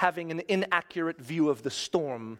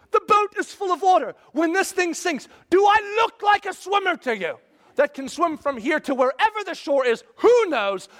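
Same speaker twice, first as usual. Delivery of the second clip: much higher, much louder panicked yelling; frantic outraged shout; 8 dB louder than usual. Retold or imitated. Preacher playing a disciple shouting back at Jesus, frantic. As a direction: rant like a terrified disciple. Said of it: "and I'd say".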